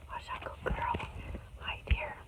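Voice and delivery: soft whispered coo to a puppy; hushed, voiceless pet talk; tender whisper. Real, not acted. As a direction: whisper a gentle greeting to a pet.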